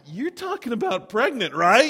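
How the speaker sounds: high, loud, incredulous check; startled disbelief. Retold or imitated. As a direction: replay a taken-aback question, voice shooting up. Retelling his startled reply to Gloria.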